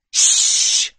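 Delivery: a sharp, loud voiceless hush; urgent shush. Acted. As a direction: shush him hard and fast. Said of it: "cried the miner".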